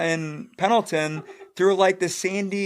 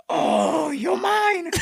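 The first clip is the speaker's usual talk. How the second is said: mock mad staff sergeant; 11 semitones higher, much slower than usual. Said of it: "He's like".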